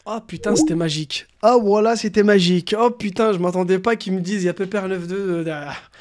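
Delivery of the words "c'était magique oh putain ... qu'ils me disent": ordinary talk, nothing marked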